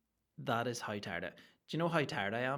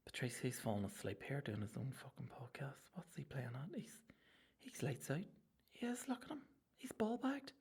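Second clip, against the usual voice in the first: hushed whisper so he doesn't wake up; real conspiratorial whisper about the dozing host; 10 semitones higher, 5 dB quieter than usual. Real, not acted. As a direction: whisper it behind the sleeping host's back. Host asleep, talking behind him.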